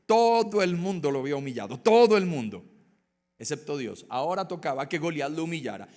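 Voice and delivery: normal talking voice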